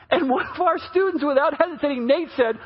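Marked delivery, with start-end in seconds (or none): none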